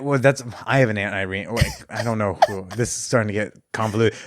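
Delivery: ordinary delivery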